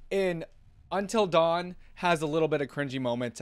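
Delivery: normal talking voice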